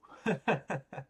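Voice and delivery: quick high chuckle; short laugh. Real, not acted. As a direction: laugh at the card. Laughs at Slash Draw.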